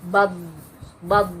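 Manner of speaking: bab bab with the closing b held; both bab kept closed, no rebound; held bab demo. Shown as a demonstration. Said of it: "seperti itu, eh, suaranya".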